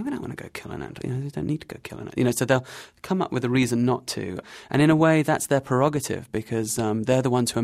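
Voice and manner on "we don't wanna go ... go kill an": high, fast, breathy mock whisper; mock bushmen grumble. Guest imitating the bushmen's refusal, high fast whisper. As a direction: rattle it off like reluctant hunters whispering. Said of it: "you hear them talking about this and they say".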